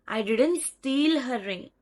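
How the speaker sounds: slow, with "steal" drawn out and pushed; stress on STEAL. Shown as a demonstration. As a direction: stretch and punch the word "steal". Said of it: "that person did not steal, but did something else".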